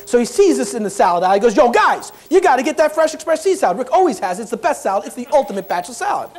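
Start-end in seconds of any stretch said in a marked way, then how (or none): 1.6-6.3 loud, hurried New Yorker calling out to the guys; excited New Yorker imitation; typical New Yorker. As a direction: call it out higher, like a brash New Yorker. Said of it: "he's a typical New Yorker".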